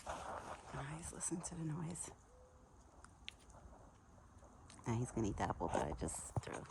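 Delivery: unvoiced soft whisper. Real, not acted. Owner whispering while her pet listens and eats. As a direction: hush so the animal keeps eating. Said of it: "whispering not to disturb".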